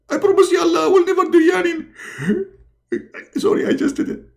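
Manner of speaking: very high, frantic promise; hammed-up squeaky panic; Muhammad. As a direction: squeal a vow, then gasp an apology. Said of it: "and Muhammad, he answer".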